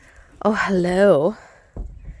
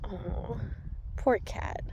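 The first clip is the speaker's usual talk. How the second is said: soft pity; 13 dB quieter, slower than usual. Real, not acted. Pitying the cat.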